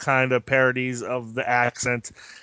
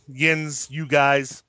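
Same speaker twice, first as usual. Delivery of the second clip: ordinary talk, nothing marked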